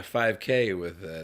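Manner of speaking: ordinary talk, nothing marked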